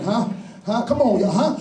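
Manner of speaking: ordinary talk, nothing marked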